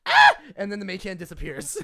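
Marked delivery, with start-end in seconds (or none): 0.1-0.4 sudden short "Ah!" shriek; goblin's startled scream. Acted. Goblin spots a hand on the crossbow, startled scream. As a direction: yelp at a nasty surprise. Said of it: "looks down and goes".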